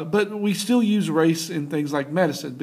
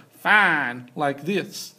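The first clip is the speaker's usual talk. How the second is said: slow Southern drawl on "fine"; Southern accent; much slower than usual. Shown as a demonstration. Teacher showing students how accent changes a word, Southern accent. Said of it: "that's a Southern accent".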